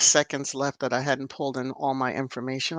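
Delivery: ordinary talk, nothing marked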